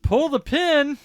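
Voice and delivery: high, loud mock battle yell; calling out. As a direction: shout it across a gunfight, imitating. Calling out orders in a gunfight.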